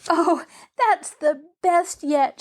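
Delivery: high, drawn-out gleeful cry; crowing. Acted. Girl delighted by the man's centipede remark, crowing. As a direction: crow with delight at the joke. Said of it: "she crowed".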